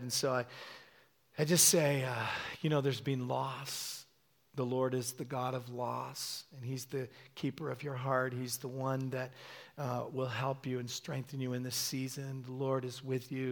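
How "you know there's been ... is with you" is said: soft, unhurried comfort; gentle consoling prophecy. Retold or imitated. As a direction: minister quietly to someone grieving. Retold gentle prophecy over grieving couple.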